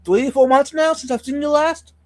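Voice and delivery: ordinary delivery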